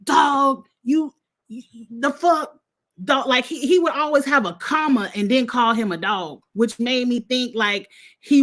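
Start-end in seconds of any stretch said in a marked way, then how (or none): 0.0-3.3 imitated accusation, higher pitch, tossed-out "dog"; Tucker. Retelling a housemate confronting a liar, imitated accusation. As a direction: mimic a housemate throwing out an accusation. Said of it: "the way he was throwing it out there".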